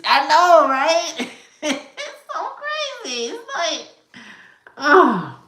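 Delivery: high, breathless giggling words; laughing. Real, not acted. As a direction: let the laugh take over. Laughing with viewers about videos.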